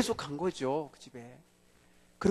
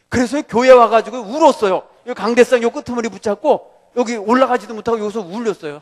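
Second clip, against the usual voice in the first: fervent preaching; 5 semitones higher, 9 dB louder than usual. Acted. Preaching at full force.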